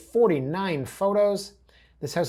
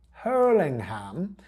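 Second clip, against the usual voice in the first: slow, drawn-out goofy name; goofy, stretched-out comic delivery; Adam Sandler voice; 3 semitones higher, 4 dB louder, much slower than usual. Acted. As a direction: draw the name out in a silly voice. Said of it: "I got my inner Adam Sandler there".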